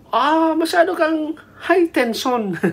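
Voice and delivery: very high-pitched, playful exclamation; hammed-up high voice; acted high-energy remark. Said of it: "pag sinabihan kang".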